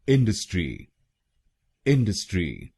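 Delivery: इंडस्ट्री stressed on इन; इंडस्ट्री with the first syllable strong; इंडस्ट्री the right way; correct stress. Shown as a demonstration. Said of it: "पहले शब्दांश पर यानी आईएन पर जोर".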